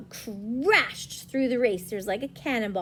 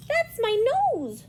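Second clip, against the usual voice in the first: high, loud squeaky protest; squeaking; 12 semitones higher, 5 dB louder than usual. Acted. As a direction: squeak indignantly like a shrew. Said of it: "Shrew squeaked".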